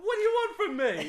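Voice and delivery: squeaky high put-on voice, loud; exasperated Tom. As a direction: play a flustered bloke caught in the act. Tom's reply, acted.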